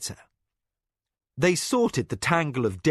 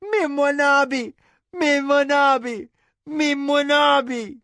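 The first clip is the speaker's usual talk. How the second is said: put-on high frantic child scream; 13 semitones higher, 5 dB louder than usual. A narrator's reading. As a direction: scream for the nurse in panic.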